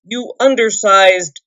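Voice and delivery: loud, sputtering insults; dwarf shaking the hobbit, shouting; angry shout. A narrator's reading. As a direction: shout at a loss for words. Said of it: "he shouted at a loss for words".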